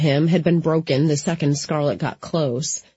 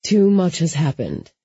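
ordinary delivery

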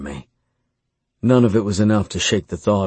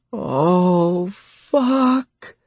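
high, drawn-out cry; 11 semitones higher than usual. A narrator's reading. Lover obeying his command, crying out high. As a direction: cry out high without much volume. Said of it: "she cried out".

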